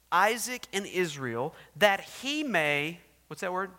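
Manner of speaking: loud, booming scripture reading; preaching scripture. Acted. Preacher reading the king's letter to the church, booming.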